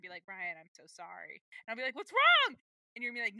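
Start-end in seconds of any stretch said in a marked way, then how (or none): 0.2-1.4 low, slow, soft imagined apology; acted hushed, grave sincerity; mock-sincere apology. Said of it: "you're gonna sincerely". 1.9-2.6 high, loud mock panic; hammed-up alarmed question; acted alarm. Acting alarmed reply to grave news.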